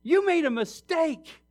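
high, loud objection; indignant protest to God. Retold or imitated. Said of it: "you stop and you're going".

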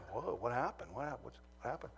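put-on a worried kid whispering back; hushed alarm. Alarmed reply to brother.

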